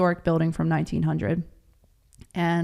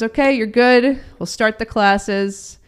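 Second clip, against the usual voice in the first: laughing rabbi; 4 semitones higher, 7 dB louder, slower than usual. Retold or imitated.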